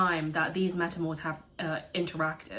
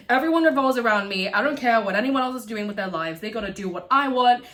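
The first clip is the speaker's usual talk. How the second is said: louder, higher mock-entitled voice; mocking self-centred; 4 semitones higher, 6 dB louder than usual. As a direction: parody a man who thinks he's the centre.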